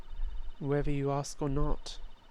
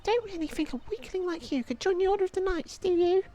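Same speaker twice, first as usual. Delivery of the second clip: put-on high, teasing child's voice; mocking kid. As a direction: taunt him like a bratty kid, pitched way up. Second child mocks the boy.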